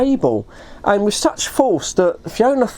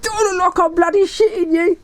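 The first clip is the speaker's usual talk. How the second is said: high-pitched, indignant comeback; retold exasperated outburst, raised pitch; worked up; 10 semitones higher than usual. Dave snapping back at his disbelieving friend, worked up. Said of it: "He was so worked up".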